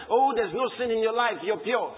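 ordinary talk, nothing marked

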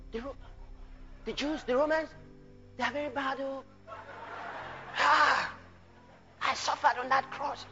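soft, halting, sighing lament; mock a feeble sufferer moaning softly; self-pitying complainer. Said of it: "he didn't come and say".

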